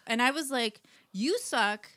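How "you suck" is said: worked-up retelling of telling off a boss; higher, louder mock outburst; indignant retort. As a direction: let the indignation build. Acting out angry retort to boss.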